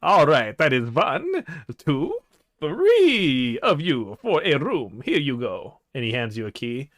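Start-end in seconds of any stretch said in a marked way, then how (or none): 0.0-5.8 put-on counting slowly in a light Count-like accent; lightly voiced vampire innkeeper. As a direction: count them off like a campy vampire. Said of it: "you have an accent".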